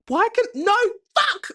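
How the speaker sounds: sudden high frustrated outburst; blurted high curse; frustrated yelp. Real, not acted. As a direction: cry out at the flub. Flubbed intro, frustrated outburst.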